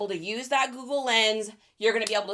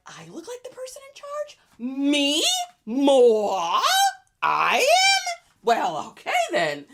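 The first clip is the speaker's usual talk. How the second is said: put-on a bored teen suddenly flattered; flattered seller; 4 semitones higher, slower than usual. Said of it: "And they're like".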